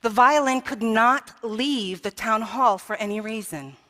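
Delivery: normal talking voice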